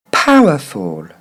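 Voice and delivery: slow "Powerful", PAH-wuh-fol; careful English model of "Powerful", slowly; correct English pronunciation. Shown as a demonstration. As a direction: draw out "Powerful", stress first, falling to a low fol. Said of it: "il faudrait dire".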